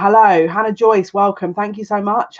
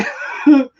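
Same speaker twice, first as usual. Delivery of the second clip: brief, higher-pitched chuckle; short laugh; 8 semitones higher, 3 dB louder than usual. Real, not acted. Host laughing over a very late night, short chuckle. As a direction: let a little laugh slip out. Laughs at staying up till morning.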